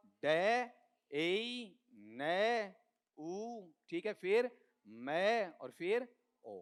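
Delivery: "डे, ए, ने, उ" as separate drawn-out sounds; sound-by-sound pronunciation. Shown as a demonstration. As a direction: sound out "डे, ए, ने, उ" one by one. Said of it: "बताओ पहले क्या आएगा?". ("डे, ए, ने, उ" अलग-अलग, खींचकर)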